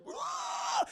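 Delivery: very high, strained "rah"; imitated monster roar. Infected Usher turning on them, imitated roar. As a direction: screech it like a creature.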